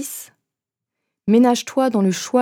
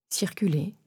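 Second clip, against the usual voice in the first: soft breathy whisper; 8 dB quieter than usual. Acted. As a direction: breathe the word out. Single word within meditation.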